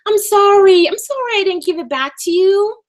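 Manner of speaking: high, loud, sing-song fake sorry; mock-sweet sorry to JD; mock apology. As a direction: overdo the apology to mock him. Mocking apology to JD.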